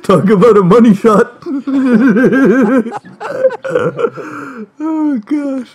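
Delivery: cracking up mid-sentence; wheezing with laughter; laughing hard. Real, not acted. Helpless laughter at the story.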